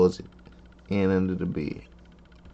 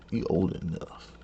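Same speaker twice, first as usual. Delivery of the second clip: usual speaking voice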